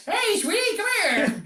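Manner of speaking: high, put-on hollering voice; hammed-up beckoning shout; mock catcall. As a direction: call out in a comic high voice. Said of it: "Listen to the girls going".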